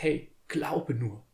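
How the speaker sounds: Jesus gently turning the father's gaze, softly and slowly; soft reassurance. Retold or imitated. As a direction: say it like a quiet encouragement. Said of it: "hin zu Jesus und sagt".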